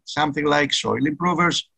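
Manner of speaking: usual speaking voice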